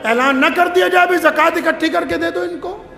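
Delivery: high, forceful pulpit rebuke; indignant preaching. Real, not acted. Preacher rebuking those who demand dowry, indignant preaching. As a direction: denounce them before the congregation.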